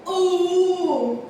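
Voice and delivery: "U" held long as one vowel; letter sound demo. Shown as a demonstration. Teacher showing children what H with U says, drawn-out vowel demo. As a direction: sound out "U" alone, sustained. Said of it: "E o H com U".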